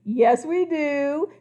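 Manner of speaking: slow, loud and gleeful; grinning. Acted. Crocodile grins at the king, slow and cheeky. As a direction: grin through the words.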